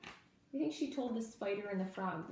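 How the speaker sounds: ordinary delivery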